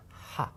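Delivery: "ha" with a pure breathy Arabic h; soft "ha", air through a tight throat; hard H. Shown as a demonstration. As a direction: breathe out the h of "ha" without grating. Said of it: "it's got a hard H sound".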